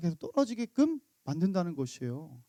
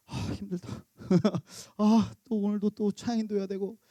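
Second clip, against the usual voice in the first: 지친 한숨. Retold or imitated. Tired ministers complaining.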